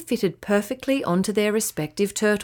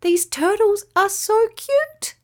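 put-on much higher, slow girlish voice; cute little girl; 11 semitones higher, slower than usual. A narrator's reading. Emma gushing over the tiny turtles, high girlish coo.

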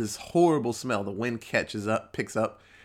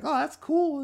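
very high, squeaky put-on voice; eager youngster. Young worker agrees to see cool stuff, eager youngster. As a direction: caricature an agreeable kid in falsetto.

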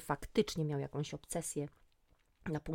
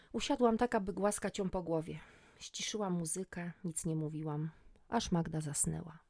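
ordinary delivery, nothing marked

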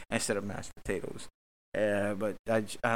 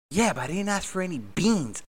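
put-on higher, whiny girlfriend complaint; mock girlfriend protesting, much higher; mimicking girlfriend; 8 semitones higher than usual. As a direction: her voice, pitched up to argue. Acted quarrel with girlfriend.

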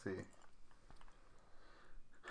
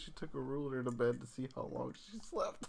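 normal talking voice

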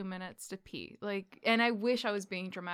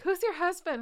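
receptionist voice; 10 semitones higher, 5 dB louder than usual. Retold or imitated. Dentist receptionist voiced in story.

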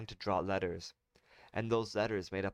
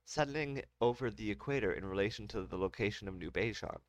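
ordinary delivery

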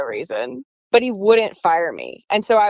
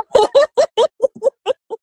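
a high, loud burst of laughter; big laugh; 6 dB louder than usual. Real, not acted. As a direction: laugh out loud at the absurd plane. Laughing at the tiny plane.

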